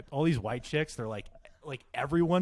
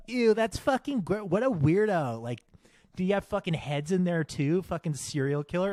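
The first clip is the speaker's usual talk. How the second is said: high, loud imitation of judgmental girls; mock disgust; 6 semitones higher, 5 dB louder than usual. Girls reacting to the fridge story, mock disgusted. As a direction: recoil as the appalled friends, pitched up. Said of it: "they were all like".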